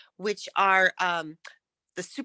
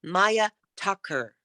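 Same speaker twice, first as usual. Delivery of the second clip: MAYA up, TUCKER dropping down like a sentence's end; falling tune; slower than usual. Shown as a demonstration. Coach showing a student how to say her name, falling tune. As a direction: say "Maya Tucker" slowly, landing down on Tucker. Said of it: "so that you go down".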